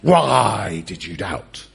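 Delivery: low, slow, strained bellow on WHY; mock angry rebuke. Jesus kneeling on Pete's chest, mock angry rebuke. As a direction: drag out "Why" in a hammed-up scolding growl. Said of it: "Jesus has got his knee on Pete's chest".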